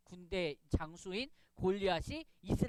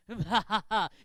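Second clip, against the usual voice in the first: loud, hammed-up villain laugh; mock evil laugh; 8 dB louder than usual. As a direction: gloat with a staged "mwahaha". Mock villain's gloating after scheme.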